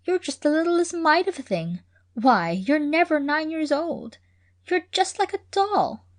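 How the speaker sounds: put-on girl cooing over a tiny guest; delighted young girl. A narrator's reading. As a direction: coo over her like a doll. Lou fussing over little Hanny.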